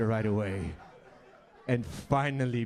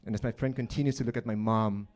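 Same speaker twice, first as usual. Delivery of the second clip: ordinary delivery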